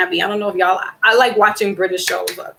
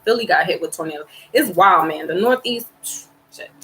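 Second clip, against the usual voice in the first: normal talking voice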